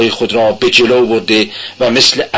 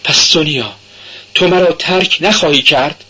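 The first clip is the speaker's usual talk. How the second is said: almost imploring; 7 semitones higher than usual. A narrator's reading. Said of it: "با نگاهی تقریباً تضرع آمیز گفت".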